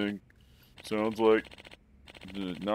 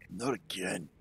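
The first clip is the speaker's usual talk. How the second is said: put-on tired old man, voice lowered; old guy voice; 5 dB quieter than usual. Shown as a demonstration. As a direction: try it as a weary old man. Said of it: "I can't get the old guy voice".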